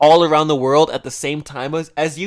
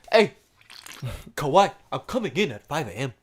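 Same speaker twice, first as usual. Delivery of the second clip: bad African accent; 3 semitones lower, 8 dB quieter than usual. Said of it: "I can't even do a good African accent".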